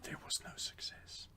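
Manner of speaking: whispered dry jab; whispered aside. Real, not acted. Friend whispering a snarky correction about Uwe Boll's films. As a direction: whisper the put-down off mic.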